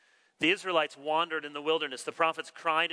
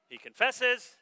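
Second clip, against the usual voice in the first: ordinary talk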